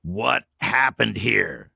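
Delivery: slow, deliberate, every word weighed; slow repeat. Acted. Old man pressing the stranger again, slow and deliberate. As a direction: repeat it slowly, pressing for an answer. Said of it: "repeated slowly".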